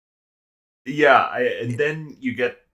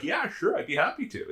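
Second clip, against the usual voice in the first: meek agreement; 7 semitones higher, 6 dB quieter than usual. Retold or imitated. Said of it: "the main character's like".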